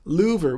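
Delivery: "louver" with an oo in place of short i; mispronounced liver. Shown as a demonstration. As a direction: say "louver", LOO-ver, for liver. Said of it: "has a lot of oohs in it".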